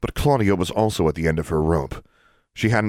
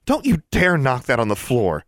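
sharp, higher scolding mother; snapped warning; 7 semitones higher than usual. A narrator's reading. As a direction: stop the child with a sharp snap. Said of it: "she snapped".

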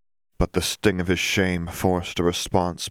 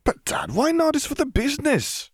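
put-on much higher, whining young man; pleading son. A narrator's reading. Son begging his father for the cloak, much higher and pleading. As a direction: beg like a son who won't take no.

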